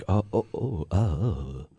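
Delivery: low, soft, drawn-out mock-dramatic ohs; mock soap-opera oohs. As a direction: parody lingering soap-opera reactions with murmured ohs. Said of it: "These lingering looks with multiple facial expressions".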